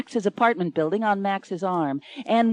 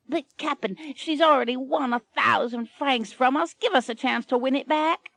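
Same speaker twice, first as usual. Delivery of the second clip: pleading pirate; 6 semitones higher than usual. Acted.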